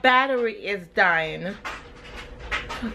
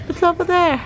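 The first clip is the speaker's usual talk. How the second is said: higher singsong, stress on over; 6 semitones higher than usual. Real, not acted. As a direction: coo it again. Talking to baby.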